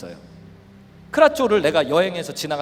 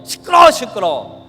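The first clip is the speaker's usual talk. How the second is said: slow, loud shushing; mock irritated dad silencing a child; acted impatient scolding; annoyed father; 3 semitones higher, 9 dB louder, slower than usual. Acts a dismissive father.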